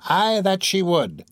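high, slow crone agreeing; old crone crony. Acted.

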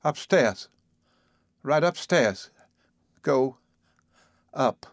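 high, flustered, in short bursts; a touch of hysteria, halting between words; hysterical. A narrator's reading. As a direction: nervously send him away in fragments. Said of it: "she cried hysterically".

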